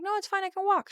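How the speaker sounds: a nervous guest insisting he will walk; high insistent refusal. Retold or imitated. Uncle refusing the strange woman's ride, high and insistent.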